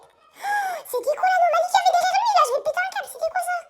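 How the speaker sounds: high spooked voice; panicked and rattled, voice up high. Real, not acted. Spooked by anomaly.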